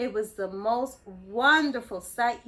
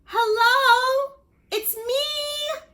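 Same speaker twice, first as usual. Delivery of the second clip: high, loud call; little fish calling to a friend; 13 semitones higher, 8 dB louder, much slower than usual. Acted. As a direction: call out across the water. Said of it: "he called".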